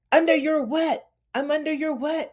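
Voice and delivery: high, incredulous repeat of his words; a hammed-up offended wife throwing his phrase back; indignant mock echo. Wife echoing the commander's words, high and indignant.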